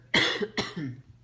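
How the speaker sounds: a sharp cough mid-sentence; coughing fit. Real, not acted. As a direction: cough once, then excuse yourself. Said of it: "excuse me".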